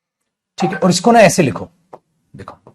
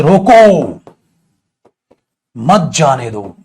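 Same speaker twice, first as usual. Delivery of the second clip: loud "रोको", long stop, then lower, slow "मत जाने दो"; pause after रोको; 3 semitones lower, 5 dB louder, much slower than usual. Shown as a demonstration. Teacher showing the other meaning, pausing right after "रोको". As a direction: halt hard after "रोको", keep "मत जाने दो" together. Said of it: "ये जो ठहराव है".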